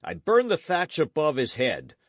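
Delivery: raging oath. Acted. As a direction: knight swearing vengeance in rage and grief. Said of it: "convulsed with rage and grief".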